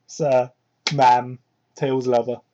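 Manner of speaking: normal talking voice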